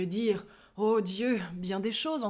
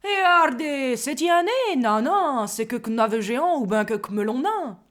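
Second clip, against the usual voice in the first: country bumpkin; 4 semitones higher, 7 dB louder than usual. Acted. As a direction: play a gawking yokel. (accent paysan campagnard)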